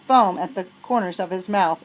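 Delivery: plain reading voice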